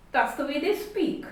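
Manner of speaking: usual speaking voice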